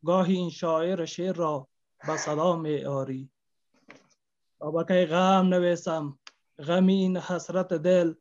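loud, slow declamation of a sad love poem; grieving poet reciting to the audience; poem recitation. Acted. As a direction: declaim each line like a lament to the beloved.

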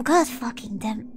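ordinary talk

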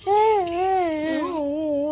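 imitating a child's whimper, high; mock whimper. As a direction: whine "ఊ" like a crying toddler. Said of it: "ఊ అని ఏడ్చావా?". (పిల్లల ఏడుపు అనుకరణ)

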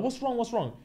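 high, soft fussing; mock concern. Girls asking their crying friend, mock concern. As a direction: imitate worried friends crowding round.

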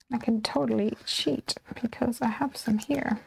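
a sneaky aside, under the breath; whispered confession to the viewers; conspiratorial whisper. Real, not acted. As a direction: whisper it like a little secret. Whispered aside about cheating.

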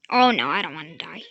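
panicked. Real, not acted. Panicking in game.

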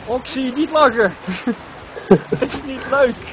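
laughing while scolding; fighting back giggles, loud and high; suppressed giggles. Real, not acted. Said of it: "is eigenlijk best wel hilarisch".

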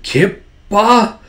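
high, halting question; dazed recognition. Acted. Micah surfacing from possession, high hesitant question. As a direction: break the name in two, unsure.

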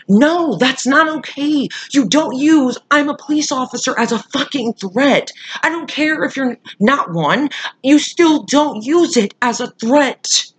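high-pitched indignant scolding; outraged. Real, not acted. Telling off the woman who claimed to be police, outraged. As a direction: lay down the law, high and emphatic. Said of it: "that gets me so bad".

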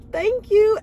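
high, loud forced thanks; strained thanks. Retold or imitated. To a complimenting lady while peeing, strained high thanks. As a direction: thank her mid-pee. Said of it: "I was like".

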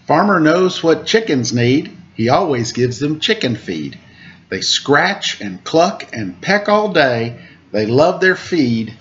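lilting storybook rhymes, slow refrain; reading to children. A narrator's reading. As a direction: read the rhyme aloud to little listeners. Reads picture book to children.

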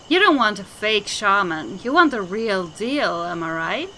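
usual speaking voice

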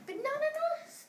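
very high little oyster voices; tiny breathless creatures protesting; high, squeaking cry with a giggle; squeaky oysters. A narrator's reading. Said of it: "the oysters cried".

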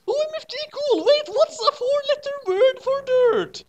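sexy voice. Acted. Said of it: "he asked in a sexy voice".

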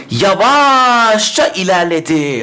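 Yavaşça drawn out, very slow; slow demo. Shown as a demonstration. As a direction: stretch out Yavaşça ilerledi slowly. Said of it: "Şimdi nasıl ilerledi?". (yavaşça, ağır ağır)

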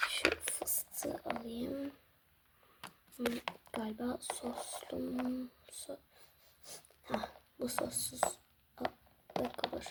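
slow voiceless whisper, thinking aloud. Acted. Father whispering to himself while picking snacks. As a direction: mull it over under your breath. Choosing snacks quietly at dawn.

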